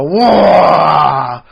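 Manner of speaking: loud mock war cry; orc battle cry. Gamer imitating orc players at the table, a loud mock war cry. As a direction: bark it like an orc player charging. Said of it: "you hear someone go".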